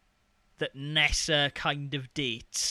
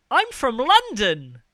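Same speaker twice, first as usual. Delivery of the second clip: loud, high, drawn-out Londoner impression; London accent; 11 semitones higher, 12 dB louder, slower than usual. Mocking the kid's voice acting, exaggerated London accent. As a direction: proclaim it in a hammed-up London voice. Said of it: "he's clearly like".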